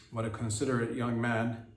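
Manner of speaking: ordinary talk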